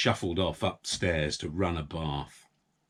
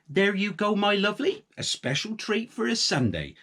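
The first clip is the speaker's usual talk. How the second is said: fond coo; 12 semitones higher than usual. A narrator's reading. Farm wife fussing over her pig. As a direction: coo to a pet.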